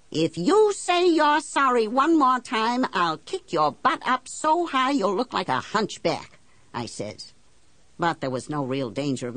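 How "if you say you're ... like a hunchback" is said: high, loud, exasperated outburst; fed-up warning; angry threat. Acted. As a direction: threaten him, starting high and forceful. Acted threat at banker.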